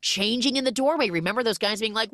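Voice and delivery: ordinary talk, nothing marked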